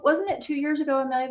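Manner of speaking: ordinary talk, nothing marked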